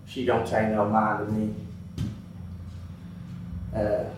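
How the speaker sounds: usual speaking voice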